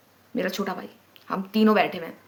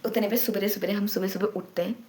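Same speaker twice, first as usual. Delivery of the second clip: ordinary talk, nothing marked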